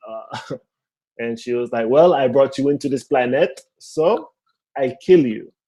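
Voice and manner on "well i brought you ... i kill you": put-on matter-of-fact threat, Haitian accent; mom's thick Haitian accent. Mom warning her son, thick Haitian accent. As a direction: imitate a Haitian-accented mother justifying herself. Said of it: "my mom has a very thick accent".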